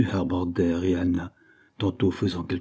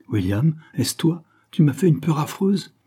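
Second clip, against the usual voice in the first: higher-pitched startled questioning; startled fright; 7 semitones higher than usual. A narrator's reading. Girl surprised on the path by William, startled. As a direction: read her fright with a lifted voice. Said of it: "en poussant un cri perçant".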